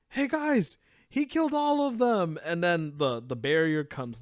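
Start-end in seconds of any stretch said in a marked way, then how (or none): 0.1-2.4 excited. Retold or imitated. Excited character retold.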